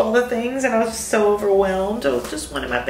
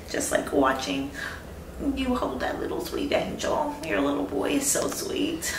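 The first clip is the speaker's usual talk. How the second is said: holding back tears; 2 semitones higher, 8 dB quieter than usual. Real, not acted. New mother near tears about her baby, softly choked up. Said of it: "I feel like I'm gonna cry".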